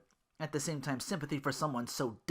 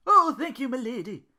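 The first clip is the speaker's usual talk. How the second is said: excited; 13 semitones higher, 10 dB louder than usual. Acted. Duke delighted.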